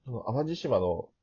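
ordinary talk, nothing marked

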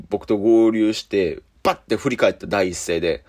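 ordinary talk, nothing marked

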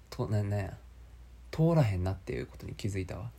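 normal talking voice

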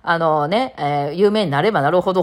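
usual speaking voice

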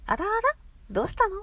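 高く大きな声で「あらあら？どうしたの？」と心配そうに; 相手を気づかって驚いてみせる; 気づかう驚き. Shown as a demonstration. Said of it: "っていう意味で使う". (高めの大きな声で優しく驚く)

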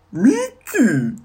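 slow, high, loud cartoon mouse voice; Mickey voice. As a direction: drag the name out in a squeaky falsetto. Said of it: "ちょっとやってみよ".

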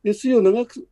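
normal talking voice

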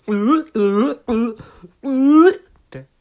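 loud, high, slow retch mixed with a moan; half vomit, half moan. Retold or imitated. Comedian mixing retching and moaning onstage, loud retching moan. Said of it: "嘔吐と喘ぎ声を混ぜていきます".

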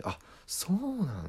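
surprised reply. Retold or imitated. Makeup lady's reaction.

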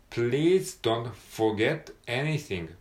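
slow, separated "please don't forget anything"; slow model reading. Shown as a demonstration. Teacher reading the line slowly as a model. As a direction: read the sentence slowly for learners. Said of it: "forget ね".

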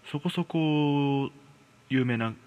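plain reading voice

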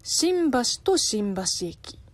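slow, careful Japanese pitch accent on 新橋 and 新橋駅; accent demo. Shown as a demonstration. Host demonstrating pitch accent to a viewer, slow and careful. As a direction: say 新橋 and 新橋駅 slowly, showing the pitch accent of each. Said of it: "日本語の発音がややこしい".

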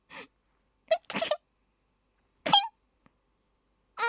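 high squeaky cute sneeze; sneezing. Shown as a demonstration. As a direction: sneeze as cutely as possible. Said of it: "私可愛いと思っている女子のくしゃみ".

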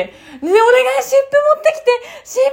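very high, loud cry for 湿布; pleading call. Retold or imitated. A woman reenacting begging her sister for a compress. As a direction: beg loudly for a compress. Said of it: "って言ってんのに".

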